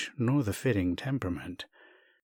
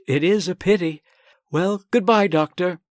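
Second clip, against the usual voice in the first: much higher, louder bright goodbye; cheerful farewell; 8 semitones higher, 8 dB louder than usual. Acted. Sasha leaving the doctor, higher cheerful farewell. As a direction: sign off brightly, pleased.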